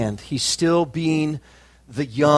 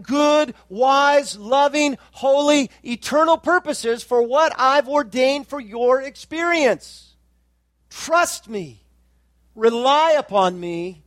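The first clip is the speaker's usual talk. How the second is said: preacher voicing Jesus, impassioned; full-force preaching; 6 semitones higher than usual. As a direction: plead for him as Christ, building to a shout.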